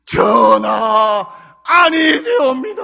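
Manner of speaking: booming, drawn-out mock protest; Joseon court minister. Ministers imploring the king, loud hammed-up wail. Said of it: "우리나라의 조선 왕조 오백 년".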